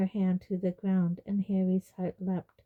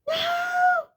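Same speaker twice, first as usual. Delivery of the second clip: very high, terrified scream; shriek; 4 dB louder than usual. A narrator's reading. Umbridge charged by centaurs, piercing shriek. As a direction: shriek it at the top of the range. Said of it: "she heard Umbridge shriek".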